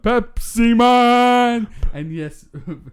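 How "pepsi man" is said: high, loud, slow dramatic shout; Pepsi Man shout. Acted. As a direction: belt it like a cheesy ad jingle.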